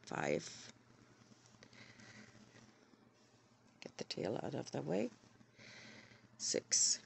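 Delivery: hoarse and raspy from a cold. Real, not acted. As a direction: rasp through a sore throat. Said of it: "Sorry for my raspy voice".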